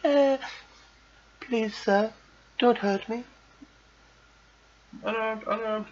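loud, very high put-on begging; squealed fake cry for mercy; mock pleading. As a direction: plead like a terrified victim, hammed up. Playing a victim begging for mercy.

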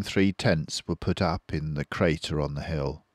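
plain reading voice

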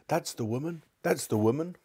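high, excited accusing cry; cried out. Acted. Fat man crying out to the constable, high and excited. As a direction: shout it out, pointing her out to the constable. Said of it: "cried the fat man".

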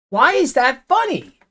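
high, firm, falling on funny; insistent demand. Real, not acted. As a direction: press the question down hard and final. Repeats annoyed at viewer.

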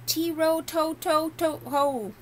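high, slow sing-song mimic of a hunting horn; merry horn call. A narrator's reading. Hunting horn heard in the forest, merry and high. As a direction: sound the horn call gaily, drawn out. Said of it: "but a merry call".

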